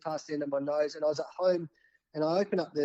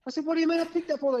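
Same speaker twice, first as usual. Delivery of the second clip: much higher, startled double-take; incredulous; 12 semitones higher, 3 dB louder, faster than usual. Retold or imitated. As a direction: squeak out the question in disbelief. Learning he tidied the bin.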